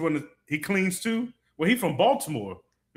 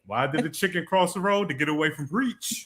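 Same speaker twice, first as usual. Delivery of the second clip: ordinary talk, nothing marked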